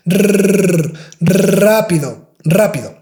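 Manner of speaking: "rápido" starting with a long rolled R; trilled R. Shown as a demonstration. Teacher drilling the strong R. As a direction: roll the R in rápido. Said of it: "hit like three, four times".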